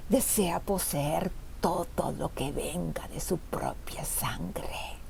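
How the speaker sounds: low, slow whispery menace; gloating jailer. A narrator's reading. Jailer tells mother about her son, low slow menace. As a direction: draw it out in a low, breathy whisper.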